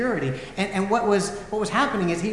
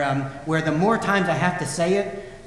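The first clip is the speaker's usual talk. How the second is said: normal talking voice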